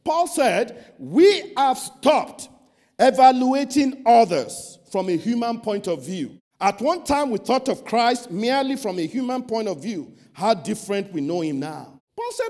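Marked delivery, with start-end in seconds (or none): 1.0-11.9 preaching scripture. Acted. Preacher quoting Paul to the congregation, loud proclamation. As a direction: proclaim the verse with force. Reads Paul's words loudly.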